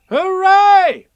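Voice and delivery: loud high cheer; full-voiced, high-pitched hooray; excited shout. A narrator's reading. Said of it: "you shouted".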